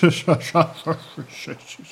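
lautes, dröhnendes Meereslachen; schallendes Lachen. A narrator's reading. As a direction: das ganze Ufer mit Lachen füllen. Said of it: "Das Meer sprach in ein schallendes Gelächter aus". (das Meer lacht schallend über Peter)

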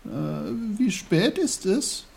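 put-on high, hesitant little-boy question; groggy start, rising high; just-woken boy Peter. A narrator's reading. Peter rubs his eyes and asks the time, high.

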